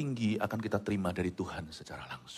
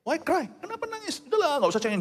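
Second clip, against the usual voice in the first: very high-pitched mock scolding; insensitive dismissal. Insensitive person tells mourner not to cry, mock dismissal. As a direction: brush off the tears in a high put-on voice. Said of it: "Sebuah pertanyaan yang kurang sensitif".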